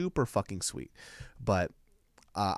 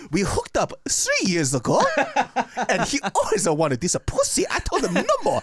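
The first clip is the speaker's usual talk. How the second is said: put-on high, louder Japanese accent; 13 semitones higher, 6 dB louder than usual. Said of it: "my Japanese accent came off kind of Italian".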